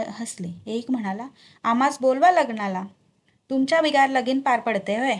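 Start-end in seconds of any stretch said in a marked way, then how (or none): 1.6-5.2 louder bantering villager; raised-voice teasing among men; hearty banter. A narrator's reading. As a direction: call out a jovial reply. Strangers banter loudly with guards.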